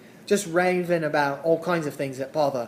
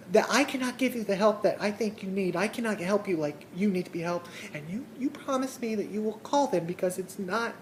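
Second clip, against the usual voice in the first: imitating a concerned lady, higher and softer; earnest pleading; 5 semitones higher, 5 dB quieter than usual. Telemarketer begging a caller to phone the hotlines, earnest pleading.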